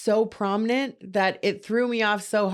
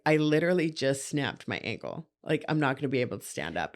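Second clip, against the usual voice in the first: low, soft matter-of-fact quote; calm deadpan; 7 semitones lower, 6 dB quieter than usual. Retold or imitated. Retelling the injury to a friend, calmly. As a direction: understate it, quiet and unbothered. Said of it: "'Cause I was so calm".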